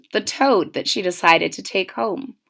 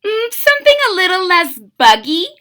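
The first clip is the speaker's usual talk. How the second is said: put-on higher, quick girl voice musing; little girl; 8 semitones higher, faster than usual. Mitzi tells the shop lady what she wants: high, quick girl voice. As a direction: think it over like a picky child. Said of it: "she said".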